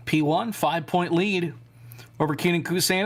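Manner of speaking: normal talking voice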